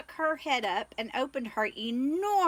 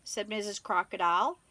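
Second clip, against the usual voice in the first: usual speaking voice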